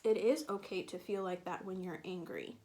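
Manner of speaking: usual speaking voice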